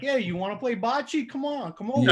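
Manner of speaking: put-on New York Italian accent. Actor at an audition overplays the New York Italian accent. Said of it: "comes in with that".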